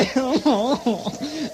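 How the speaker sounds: big booming laugh at his own pun; hearty laugh. Acted. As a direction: laugh loud and pleased with yourself.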